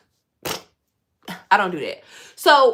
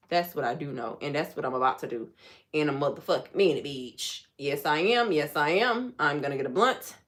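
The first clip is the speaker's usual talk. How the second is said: low, soft, winding down; calm and low; 7 semitones lower, 4 dB quieter than usual. Real, not acted. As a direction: settle the voice down low. Winding down, lower, softer.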